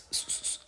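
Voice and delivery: "स, स" as a soft breathy snake hiss; just hiss "स, स" with no voice; whispered hiss. Shown as a demonstration. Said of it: "ऐसे आवाज़". ("स, स" फुसफुसाती फुफकार)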